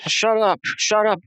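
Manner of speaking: a touch of a raving patient shouting; patient yelling. Retold or imitated. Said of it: "One of them's going like".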